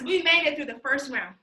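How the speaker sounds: ordinary talk, nothing marked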